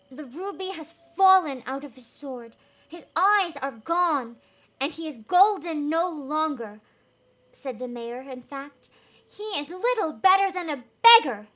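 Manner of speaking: loud, pompous complaint; mayor scornful of the statue. Acted. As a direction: bluster like a self-important mayor.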